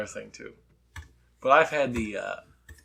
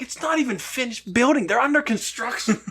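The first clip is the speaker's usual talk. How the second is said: incredulous high voice; 13 semitones higher than usual. Retold or imitated. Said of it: "And I'm like".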